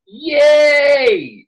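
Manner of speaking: high, loud delighted yelp; excited cheer. Real, not acted. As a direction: cheer like the wait is finally over. Cheering the Tardis interior scene.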